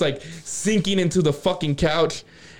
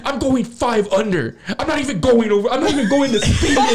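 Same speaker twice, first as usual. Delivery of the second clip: imitated angry girlfriend; 9 semitones higher, 3 dB louder than usual. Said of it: "like, the meanest look in her face".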